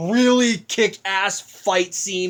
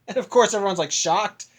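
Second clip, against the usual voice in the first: ordinary talk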